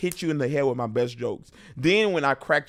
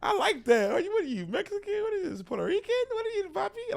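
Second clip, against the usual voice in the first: high, flirty teasing; flirty crowd work; faster than usual. Pretend. Comic flirting with a fan in the crowd, high playful. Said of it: "I'd be like".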